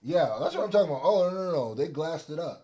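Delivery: loud, high, excited outburst. Real, not acted. Excited agreement about Fanta.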